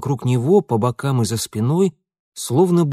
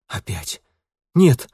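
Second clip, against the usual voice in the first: frightened cry; 3 dB louder, slower than usual. A narrator's reading. Mitya frightened outburst.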